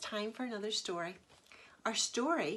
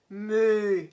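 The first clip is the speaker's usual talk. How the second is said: loud mooing cow; story cow mooing; 9 dB louder than usual. Acted. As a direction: moo like a cow. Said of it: "said the cow".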